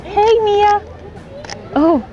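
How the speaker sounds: sing-song greeting to a dog. Real, not acted. Cooing at dog Mia.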